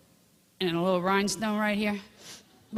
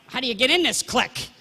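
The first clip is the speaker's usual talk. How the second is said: exasperated outburst; 6 semitones higher, 7 dB louder than usual. Retold or imitated. Retelling her own eager frustration.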